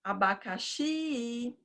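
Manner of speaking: Abacaxi with a long-held final XI; slow sing-song Abacaxi, the last syllable drawn out; calling the word. Shown as a demonstration. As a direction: softly call ABACAXI, stretching XI. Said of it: "você chama a palavra".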